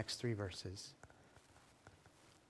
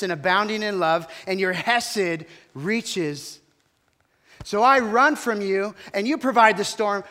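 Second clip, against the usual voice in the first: loud, higher, heated complaint; a prophet ranting at God, louder and higher; angry rant; 3 semitones higher, 10 dB louder than usual. Acted. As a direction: Jonah shouting his grievances at God. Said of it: "watch Jonah's response".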